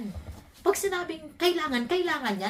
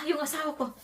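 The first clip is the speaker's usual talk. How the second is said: usual speaking voice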